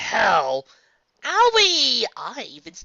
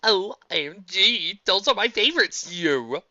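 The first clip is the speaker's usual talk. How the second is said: gushing excitement; 6 semitones higher, 9 dB louder, slower than usual. Acted. Character gushes.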